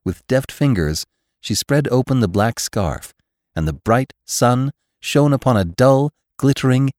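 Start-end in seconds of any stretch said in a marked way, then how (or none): none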